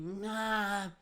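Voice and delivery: high, stretched-out kiddie call; long rising little-kid whine; child calling mom. Retold or imitated. Child calling for his mother.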